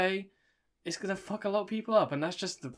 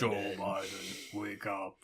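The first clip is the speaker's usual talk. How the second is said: slow, low Joe Biden wake-up call; Kurdish TikTok man impression; 9 semitones lower, 9 dB quieter, slower than usual. Friends quoting a viral Kurdish man's TikTok, slow low impression. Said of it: "of that, like, Turkish fellow".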